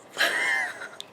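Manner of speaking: high tearful laugh of relief; squealing relieved laugh. Real, not acted. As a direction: laugh through tears, real. Relief that Rhys lives.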